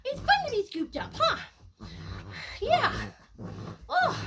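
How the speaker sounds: high, sing-song puppy talk. Real, not acted. Trainer reassuring her puppy after pickup, high baby talk. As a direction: coax the puppy cheerfully.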